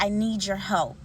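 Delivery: ordinary talk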